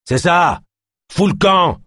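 high, loud scornful dismissal; angry shout. Acted. Philémon yells at Ganesh to get lost, angry shout. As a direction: shove him off with your voice. Suspect drives cop away.

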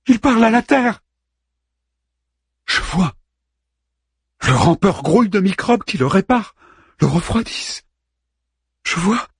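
as if seeing the whole universe at once; overwhelmed visionary cries; ecstatic awe. A narrator's reading.

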